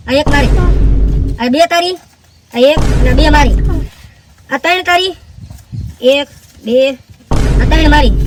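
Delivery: each share called out loud; emphatic counting. Acted. Friend splits the cash, loud emphatic counting. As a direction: slap down each note as you count.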